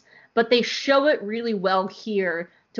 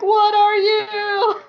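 much higher, very slow, breaking into a laugh; awed, laughing; 12 semitones higher, 7 dB louder, much slower than usual. Retold or imitated. Fan repeats her first reaction to the Bendu, laughing. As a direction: laugh through the question in disbelief. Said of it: "I was like".

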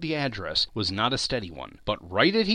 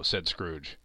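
plain reading voice

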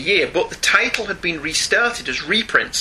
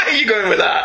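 laughing; 5 semitones higher than usual. Real, not acted. Teasing, laughing.

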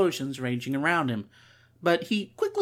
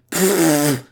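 loud burst of laughter; 9 dB louder than usual. Acted. Char laughing at surprise attack.

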